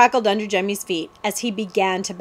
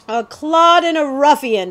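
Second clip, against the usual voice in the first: loud, higher pronouncement of contempt; haughty declaration; 6 semitones higher, 6 dB louder than usual. A narrator's reading. Prince judges the outlaw Billy, loud haughty declaration. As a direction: declare it grandly, as a prince would. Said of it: "declared the prince".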